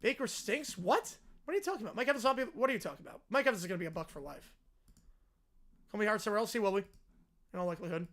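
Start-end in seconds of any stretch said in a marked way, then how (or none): none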